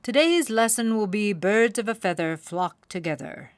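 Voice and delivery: father owl announcing the lesson; stern father owl. A narrator's reading. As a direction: lecture like a wise old owl.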